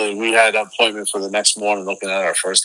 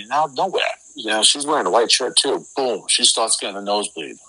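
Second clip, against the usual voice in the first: usual speaking voice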